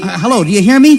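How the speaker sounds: loud call into the radio; shouting into a radio microphone. Acted. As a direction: raise your voice to reach a distant station.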